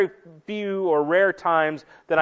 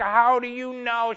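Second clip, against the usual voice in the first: imitated husband; 7 semitones higher, slower than usual. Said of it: "And he's like".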